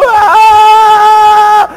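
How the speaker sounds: loud, high mock baby wail; imitated baby scream. Dad imitating his baby daughter's hair-pulling scream. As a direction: screech it like a frantic infant.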